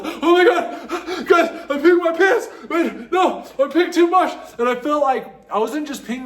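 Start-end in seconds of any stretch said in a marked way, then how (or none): 0.0-4.3 high, laughing alarm to the guys; high-pitched laughing alarm; laughing panic. Retold or imitated. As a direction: giggle and panic at once. Panicked tripping quote to friends.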